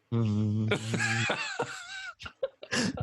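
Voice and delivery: long high mechanical hum; imitated whirr of blinds. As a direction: drone like blinds slowly motoring down. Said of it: "and then I hear just".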